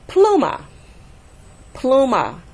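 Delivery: "pluma" with PLU stressed, high then falling; stress on first syllable. Shown as a demonstration. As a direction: hit the first syllable of "pluma", let "ma" drop. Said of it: "stress will fall on the next to the last syllables".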